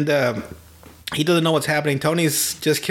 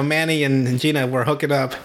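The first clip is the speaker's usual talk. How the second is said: ordinary talk, nothing marked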